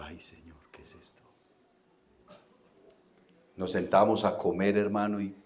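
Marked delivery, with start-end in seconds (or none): 0.0-1.2 hushed, breathless dismay, almost no voice; shocked whisper. Retold or imitated. Pastor recalls finding the bathroom smeared, whispered dismay. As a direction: gasp it under your breath in disbelief.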